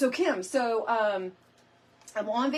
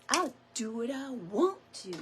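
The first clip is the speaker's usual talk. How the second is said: Lori Perkins; 4 semitones higher than usual. Retold or imitated. Crafter playfully quoting a friend from the comments, sassy imitation. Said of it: "Lori Perkins says".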